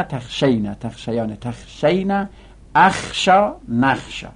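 sing-song chant of conjugations; rhythmic classroom chanting of verb forms; paradigm recitation. Acted. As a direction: rattle off the forms like a class drill.

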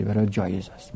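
usual speaking voice

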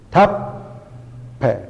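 تپ، په said as two separate beats, lips closing then opening; split into two syllables. Shown as a demonstration. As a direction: break تپ from په with a clear stop. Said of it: "این په هم از اوناست". (تپ، په را جدا و دوبار بگو)

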